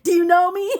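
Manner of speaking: loud, high mock-indignant question; retold indignant challenge. Fallen woman challenging the honker, loud mock indignation.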